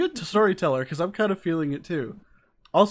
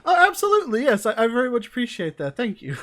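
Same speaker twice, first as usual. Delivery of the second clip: much higher, loud, gushing thanks; delighted; 8 semitones higher, 8 dB louder than usual. Real, not acted. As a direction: beam over the compliment. Host reacts to compliment.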